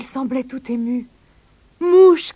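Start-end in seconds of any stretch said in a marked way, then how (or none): 1.8-2.3 high, loud excited call; raised kangaroo shout. A narrator's reading. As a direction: call the name across a distance. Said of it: "cria-t-il".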